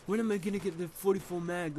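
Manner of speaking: high, eager impatience; impatient. Retold or imitated. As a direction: whine for the prize. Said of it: "I was like".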